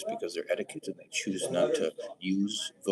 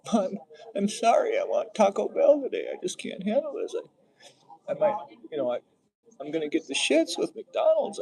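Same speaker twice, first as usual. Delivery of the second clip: much higher, louder put-on girlfriend voice; whiny girlfriend; 9 semitones higher, 5 dB louder than usual. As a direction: play her as a whiny, dithering girlfriend. Acting the girlfriend in Notebook.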